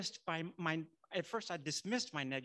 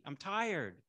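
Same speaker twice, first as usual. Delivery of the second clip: ordinary talk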